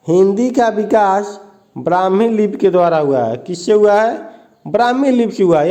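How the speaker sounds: usual speaking voice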